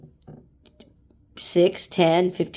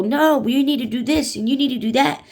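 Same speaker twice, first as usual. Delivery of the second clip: higher pitched, pushy complaint; a customer lists demands at the front desk, mock angry and higher; 6 semitones higher than usual. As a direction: imitate an irate customer listing demands. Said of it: "they'd get pissed and go".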